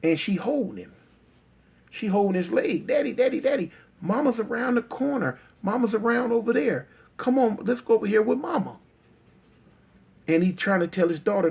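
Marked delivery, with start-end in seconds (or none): 2.9-8.8 put-on high, eager child voice; coaxing little girl. Daughter tugging at her father's leg, coaxing high. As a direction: imitate a small daughter urging Dad along.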